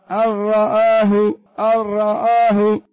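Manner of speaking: أن رآه slow and loud, noon merged: "ar-ra'ah". Shown as a demonstration. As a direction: roll straight into the ra of أن رآه. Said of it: "انطقوا مثلي". (إدغام النون في الراء بصوت عالٍ وبطء)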